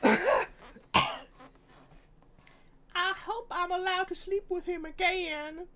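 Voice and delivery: put-on high, sweet Southern Belle accent. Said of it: "Now you're going into Southern Belle".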